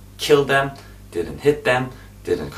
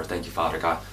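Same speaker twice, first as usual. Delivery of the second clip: normal talking voice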